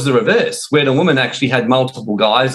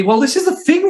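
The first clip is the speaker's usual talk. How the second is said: lightly voiced Roxy, pitch jumping up high; a hint of Roxy setting him straight; playful retold Roxy, much higher; Roxy's voice. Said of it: "She says to me".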